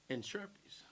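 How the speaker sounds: a soft whisper after a pause; whispered punchline; whispered aside. Real, not acted. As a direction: slip the punchline in under the breath.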